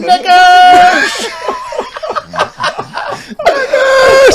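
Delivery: loud, high shout broken by laughter; laughing shout. Retold or imitated. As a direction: yell it like the whole crowd. Crowd of boys shouting a word.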